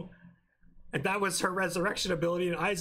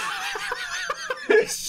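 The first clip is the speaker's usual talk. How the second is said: loud high laughing fit; helpless laughter; 6 dB louder than usual. Real, not acted. Cracking up at co-host's interruption.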